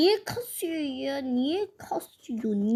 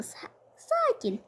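much higher, slow squeak; drawn-out squeaky voice; 12 semitones higher, 4 dB quieter, slower than usual. Acted. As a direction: squeak the word out like a kid. Kid imitating calm down.

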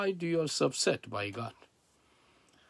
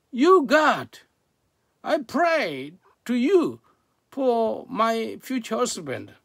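raised, high, angry complaint to God; angry shouting; 6 semitones higher, 5 dB louder than usual. Retold or imitated. Woman praying for a husband shouts at God, angry. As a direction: accuse God loudly, pitch pushed high. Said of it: "She was shouting like this".

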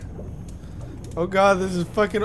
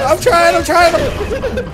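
frantic shout; 13 semitones higher, 7 dB louder, much slower than usual. Real, not acted. Straining to save friend.

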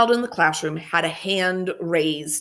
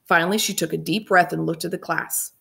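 ordinary delivery, nothing marked

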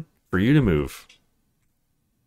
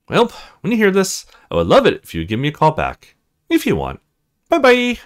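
put-on higher, lively girl's voice leaving a message; bubbly friend, a bit lonely; [name]'s voicemail voice; 6 semitones higher, 3 dB louder than usual. [name] leaving Donnie a voicemail, chatty and higher.

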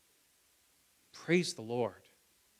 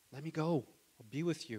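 ordinary talk, nothing marked

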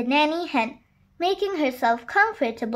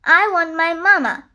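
put-on loud, higher child's demand; sulky little-donkey protest; pouting; 4 semitones higher, 6 dB louder than usual. A narrator's reading. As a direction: whine for mama with a pout. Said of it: "Little Donkey pouted".

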